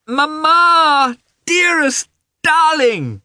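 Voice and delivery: put-on very high, loud child's cry; joyful shout. A narrator's reading. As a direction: shout it out, stretching the words. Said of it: "he shouted again".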